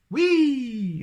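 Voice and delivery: loud high sledging whoop; shouted whee. Acted. Both friends sliding downhill, whooping loudly. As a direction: whoop down the hill. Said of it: "they shouted together".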